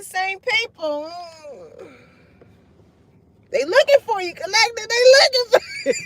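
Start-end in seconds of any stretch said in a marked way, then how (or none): 3.5-4.9 high, gleeful announcement; excited call-out. Real, not acted. Telling the collective who's looking for them, high excited call-out. 4.9-5.9 very high, loud excited shout; gleeful holler. Real, not acted. Repeating the news to the collective, gleeful high holler.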